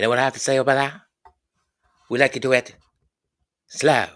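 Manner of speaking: a playful husky croon, leaning into the last word. Retold or imitated. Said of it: "That's my awful imitation of [name]".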